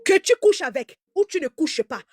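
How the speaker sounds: usual speaking voice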